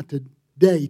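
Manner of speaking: ordinary talk, nothing marked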